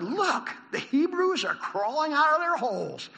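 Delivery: high, slow jeering shout. Acted. Philistines saw them coming, a high drawn-out shout. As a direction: shout it out to your comrades, drawn out. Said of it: "they shouted".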